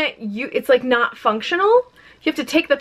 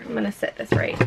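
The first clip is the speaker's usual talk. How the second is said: usual speaking voice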